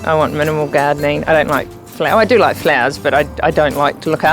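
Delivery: ordinary talk, nothing marked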